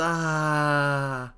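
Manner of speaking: plain reading voice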